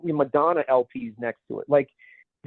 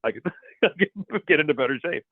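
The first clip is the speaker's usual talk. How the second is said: lightly put-on comic Hercules voice; a hint of mock dubbed hero; Bill Murray; faster than usual. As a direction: sketch impression breaking into a laugh. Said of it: "it was kind of Bill Murray".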